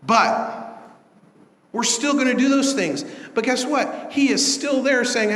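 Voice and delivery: fiery preaching. Acted. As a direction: preach it hard and rising. Preaching builds to full force.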